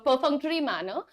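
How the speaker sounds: usual speaking voice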